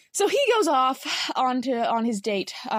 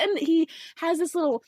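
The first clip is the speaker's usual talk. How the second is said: normal talking voice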